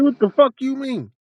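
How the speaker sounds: high-pitched mock outrage; indignant storyteller quote; retold indignant challenge. Acted quarrel with the dealer.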